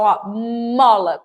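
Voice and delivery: "mola" said very slowly, holding the opening m; drawn-out mola. Shown as a demonstration. Teacher stretching "mola" so children hear its first sound, very slow. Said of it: "Quando meus lábios se unem".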